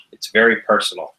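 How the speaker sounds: ordinary talk, nothing marked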